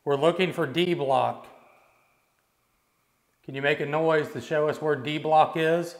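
loud, carrying calls into the dark; calling out. Real, not acted. Ghost hunter calling to spirits in the prison, loud. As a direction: project it down the empty cellblock.

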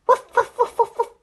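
quick, high, loud woofs; dog barking. Pretend. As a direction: imitate an excited little dog. Imitates a dog after saying dog.

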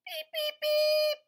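very high, loud little beeps; squeaky duckling peeps. Acted. Reading to a child, duckling peeping squeakily. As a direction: peep like a tiny duckling. Baby duck on tractor.

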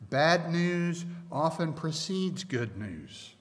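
usual speaking voice

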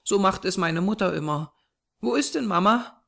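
put-on high, innocent child's voice; little girl. A narrator's reading. Small girl asking the man after her mother, innocent. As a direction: ask in a small girl's high voice.

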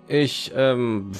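ordinary talk, nothing marked

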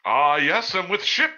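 loud, high in-character reply; too loud. Acted. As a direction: answer far too loudly for someone in hiding. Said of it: "not so loud".

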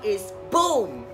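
ordinary talk

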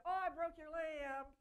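put-on slow, loud sarcastic child confession; mock child owning up, loud and slow. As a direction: ham up an unlikely admission. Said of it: "you think they're gonna say".

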